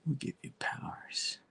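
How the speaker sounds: a secretive whispered offer. Retold or imitated. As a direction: breathe it like a shady deal. Villain handing out powers.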